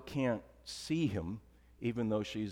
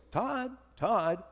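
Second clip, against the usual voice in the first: much higher, far-off calling voice; divine voice calling; 11 semitones higher than usual. Retold or imitated. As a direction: call a name as if from heaven. God's voice calling his name.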